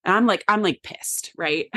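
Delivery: usual speaking voice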